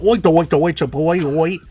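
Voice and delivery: high, loud Cockney-style oi chant; hammed-up bad British accent; mock British accent. Shown as a demonstration. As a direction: ham up a caricature Brit. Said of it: "accent I can give".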